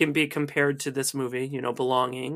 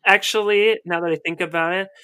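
ordinary talk

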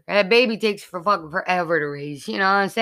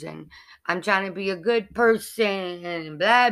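ordinary talk, nothing marked

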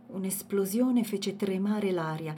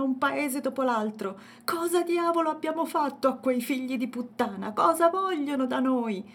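loud, higher furious ranting; thundering rage; 7 semitones higher, 6 dB louder than usual. A narrator's reading. Yeya cursing the attackers to his family, thundering anger. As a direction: rant like a man boiling over. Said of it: "tuonavano come la sua voce".

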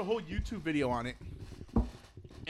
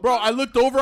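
ordinary talk

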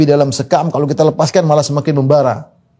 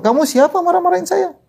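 retold rebuke; 5 semitones higher than usual. Friend confronting someone who got angry at him, retold indignant rebuke. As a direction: imitate a friend telling someone off. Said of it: "kemudian teman saya tegur".

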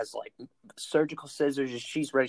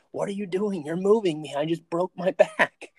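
higher, half-laughing mock yell at the paramedic; retold yelling; 7 semitones higher than usual. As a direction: replay the panicked protest, pitched up. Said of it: "I started yelling at the guy".

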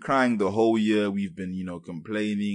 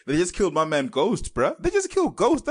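shrill outraged cry, much higher; 9 semitones higher, 5 dB louder than usual. Real, not acted. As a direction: yelp it in disbelief. Upset about TV death.

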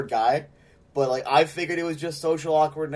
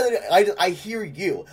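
ordinary talk, nothing marked

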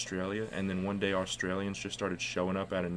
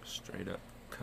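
unvoiced whispered echo; 11 dB quieter than usual. Real, not acted. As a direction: breathe it out as a whisper. Echoing friend's phrase.

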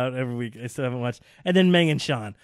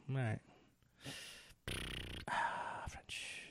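unvoiced whisper of "French"; whispered aside; 6 semitones lower, 18 dB quieter, much slower than usual. Fan whispering a jab at the French team, barely audible. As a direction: breathe the word French out as a hushed aside.

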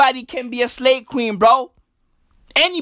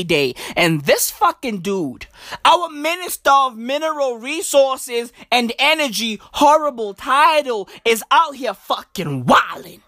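normal talking voice

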